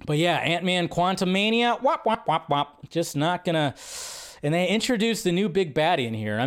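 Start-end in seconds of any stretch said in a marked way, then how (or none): 1.8-2.9 sad trombone wop. Acted. Sad trombone for box office.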